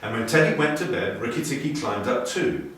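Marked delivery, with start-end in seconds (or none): none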